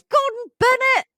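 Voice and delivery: mock curse, very high and loud; dad cursing. Daughter shows how her dad curses, mock outburst. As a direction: exclaim it like an exasperated dad, pitched right up. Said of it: "that's my dad cursing".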